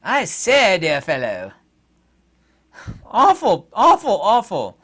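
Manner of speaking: plummy upper-class English voice, high and loud; hammed-up posh Brit lament; mock posh British accent. Mocking British officials in a hammed-up posh accent. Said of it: "do that posh accent".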